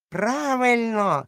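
high, loud, slow approving drawl; old Morgan. Acted. Old Morgan backing Silver, high and drawn out. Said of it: "сказав старий Морган".

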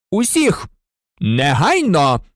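firm, emphatic classroom order; stern teacher demanding obedience; sharp command. A narrator's reading. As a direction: bark it out, stretching the last word.

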